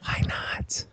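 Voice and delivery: playful whisper. Real, not acted. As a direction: whisper back teasingly. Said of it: "Why are we whispering?".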